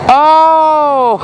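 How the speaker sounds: high startled oh, then a laugh; surprised laugh. Real, not acted. Realizing it is fertilizer.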